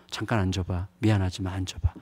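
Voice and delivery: soft, low, tender asking; gentle request. Retold or imitated. Father waking his daughter at night, soft and tender. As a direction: softly coax a sleepy child to sit up.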